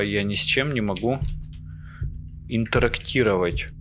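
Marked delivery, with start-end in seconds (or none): none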